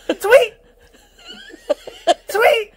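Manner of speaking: high loud birdy squeak; budgie tweet. Pretend. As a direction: chirp it like a budgie. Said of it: "make me sound like a budgie now".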